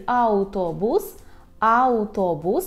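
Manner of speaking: автобус said slowly with a clear "au"; Polish pronunciation. Shown as a demonstration. Teacher models the Polish word for bus, slow Polish pronunciation. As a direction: draw out автобус with "au", not "av". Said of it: "що вимовляємо ау".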